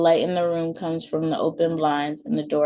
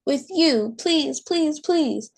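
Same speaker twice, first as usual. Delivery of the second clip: put-on high, whining child's please; begging child; 10 semitones higher, slower than usual. A narrator's reading. Maylee begging her mom at bedtime, high pleading voice. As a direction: plead like a tired toddler.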